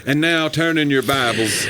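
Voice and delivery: slow, pulpit-style preacher voice; pre-sermon preacher. Retold or imitated. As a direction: open the sermon like a pastor. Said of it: "Feels like a very pre-sermon".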